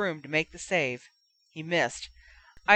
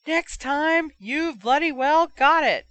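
much higher, louder cheering yell; hoarse scream; 9 semitones higher, 6 dB louder, slower than usual. Acted. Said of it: "his voice getting hoarser".

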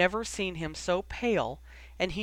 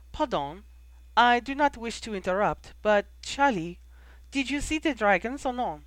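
put-on high, formal young woman's voice; bright-pitched, louder girl character; interrupting girl; 5 semitones higher, 4 dB louder than usual. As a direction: pitch it up and cut in politely but insistently.

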